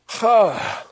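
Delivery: high, drawn-out exasperated sigh; weary sigh. Retold or imitated. Prisoner before a class of offenders, exasperated sigh. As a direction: sigh at how absurd it is.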